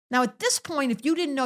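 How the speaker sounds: ordinary delivery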